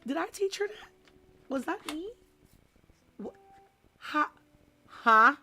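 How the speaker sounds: a teacher shocked at what a pupil learned; stunned disbelief. Retold or imitated. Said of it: "I'm just like".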